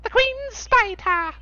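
very high, drawn-out cartoon proclamation; South Park impression. Quoting the South Park episode's conclusion, high cartoon proclamation. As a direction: stretch it out slowly in a high put-on voice. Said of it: "the problem stems from the Queen Spider".

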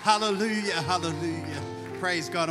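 loud fervent preacher cry; preaching at full force. Acted. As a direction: rouse the congregation, full voice.

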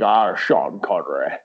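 slow Scottish drawl with slushy S sounds; Scottish impression, S turned to SH; Sean Connery. Shown as a demonstration. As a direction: put on a Scottish film-star accent, every S a SH. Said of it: "with Sean Connery you have to".

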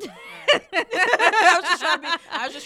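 high squealing laugh through words. Real, not acted. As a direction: crack up while talking. Bursts out laughing mid-sentence.

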